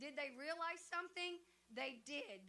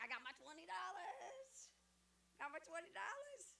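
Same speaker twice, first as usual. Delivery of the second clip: softer, stretched-out teasing brag; playful gloating; 3 semitones higher, 5 dB quieter than usual. Retold or imitated. Winner teasing others about twenty dollars, playful gloating. Gloating over her raffle money.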